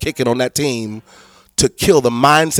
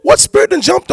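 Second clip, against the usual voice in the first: much higher, shrill mock disbelief; shocked high squeal; 6 dB louder than usual. Pastor mocking the church's shocked reaction. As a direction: squeal it out like you can't believe it. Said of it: "we're gonna be like".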